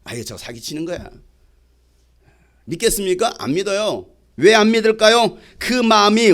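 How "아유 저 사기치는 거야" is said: low, soft scoff of a doubter; dismissive doubter. Retold or imitated. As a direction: toss it off under your breath. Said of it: "이런 믿겠습니까?". (못 믿겠다는 듯 낮고 작게)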